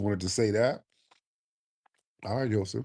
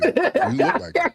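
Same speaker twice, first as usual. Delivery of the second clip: high squeal of laughter; cracking up while teasing; laughing; 9 dB louder than usual. Real, not acted. Host teasing caller, laughing.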